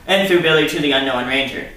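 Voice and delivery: ordinary delivery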